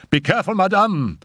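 quick, high, urgent warning; warning cry. A narrator's reading. Raoul warns the mother as she rises, urgent cry. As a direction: call out a fast warning. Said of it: "cried Raoul warningly".

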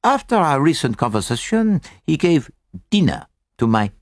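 put-on lightly accented detective, deliberate and explanatory; Poirot. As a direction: lay out the deduction step by step in a measured foreign-sounding voice. Poirot analyses the word list.

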